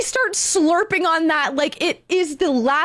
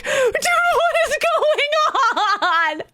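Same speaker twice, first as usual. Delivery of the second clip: very high, hammed-up tearful cry; panicked wail; 11 semitones higher, much slower than usual. Caller freaking out at mumbling man, panicked. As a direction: wail in mock despair, half laughing.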